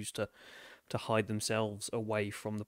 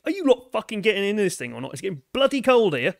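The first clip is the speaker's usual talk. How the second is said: fast, loud bellow of complaint; impatient yell; 10 semitones higher, 13 dB louder, faster than usual. Acted. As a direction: shout it out to the crew, loud and high. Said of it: "Hammer sticks his head out the".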